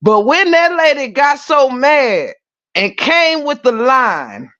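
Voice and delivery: usual speaking voice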